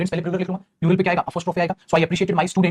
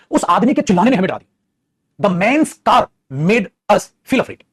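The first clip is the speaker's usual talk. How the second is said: slow, loud read-out for copying; slow, raised dictation; 2 semitones higher, 5 dB louder, much slower than usual. Shown as a demonstration. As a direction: dictate it word by word. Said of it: "अच्छा".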